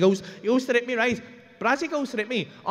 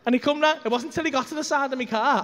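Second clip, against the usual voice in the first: ordinary talk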